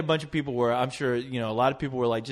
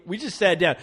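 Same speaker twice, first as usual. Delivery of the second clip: normal talking voice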